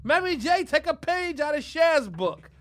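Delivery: loud, high, drawn-out appeal; mock calling out. Host pitches his friend to [name], mock calling out. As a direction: holler a hammed-up plea to a star.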